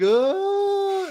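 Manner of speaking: long, high held "uhh"; drawn-out "uhh". Retold or imitated. Imitating Homer slipping back into the bushes, long high "uhh". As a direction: hold one high wordless note as you back away.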